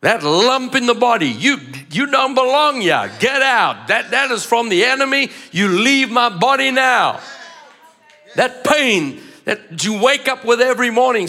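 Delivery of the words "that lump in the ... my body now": commanding the sickness. Acted. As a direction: cast it out with authority.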